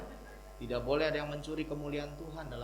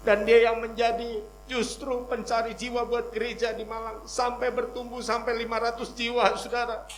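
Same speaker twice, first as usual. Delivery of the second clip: raised, emphatic sermon voice; forceful preacher's delivery; preaching; 9 semitones higher, 6 dB louder than usual. Real, not acted. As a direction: proclaim it to the congregation, building in force.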